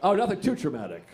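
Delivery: ordinary talk, nothing marked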